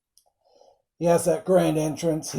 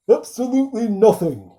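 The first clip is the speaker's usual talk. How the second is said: quoting the film reply; 7 semitones higher, 4 dB louder than usual. Retold or imitated. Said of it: "Lone Star's like".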